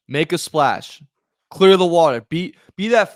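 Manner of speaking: loud, punchy call to clear the water; pumped-up loud urging; loud hype. Real, not acted. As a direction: shout it like a rallying cry. Hyping a big splash.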